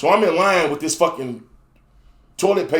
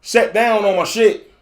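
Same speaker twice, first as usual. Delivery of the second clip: exasperated outburst; 4 semitones higher than usual. Retold or imitated. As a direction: spell it out to the nurse at last. Explains loudly to nurse in line.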